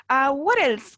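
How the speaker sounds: ordinary talk, nothing marked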